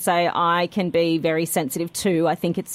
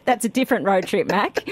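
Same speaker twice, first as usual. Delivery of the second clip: ordinary talk, nothing marked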